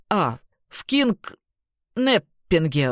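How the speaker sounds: slow, stumbling over the long word; drunken slur. Acted. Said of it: "выговорил своим заплетающимся языком сложное слово".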